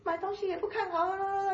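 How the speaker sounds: high-pitched mock scolding of a customer; imitating a scolding clerk. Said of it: "一连串就一直骂". (模仿店员骂人，音调高很多)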